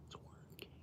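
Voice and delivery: secretive half-whisper, fading off; whispered aside. Real, not acted. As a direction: drop to a soft whisper to yourself. Muttering aside to himself.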